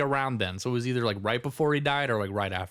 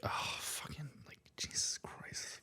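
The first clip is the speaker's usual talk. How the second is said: mortified whisper; 5 semitones higher, 15 dB quieter than usual. Retold or imitated. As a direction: whisper as if embarrassed for a drunk relative. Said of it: "it was a really weird feeling".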